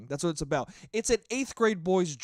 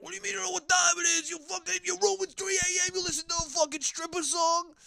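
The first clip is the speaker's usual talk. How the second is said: much higher, fast, hammed-up yell of an Italian dad; angry dad; 14 semitones higher, faster than usual. Imitating his dad scolding him about the song. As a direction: ham up the outraged dad. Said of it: "He's like".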